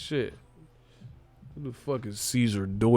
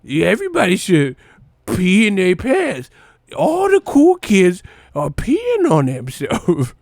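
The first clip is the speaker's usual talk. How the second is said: higher and louder movie voice; Billy Madison quote; 7 semitones higher, 8 dB louder than usual. Retold or imitated. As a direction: shout it like a goofy movie kid. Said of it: "he did the Billy Madison".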